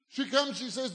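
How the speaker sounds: ordinary talk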